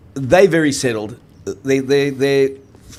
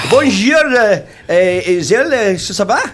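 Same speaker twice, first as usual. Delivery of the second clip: mock French greeting; 7 semitones higher, 5 dB louder, slower than usual.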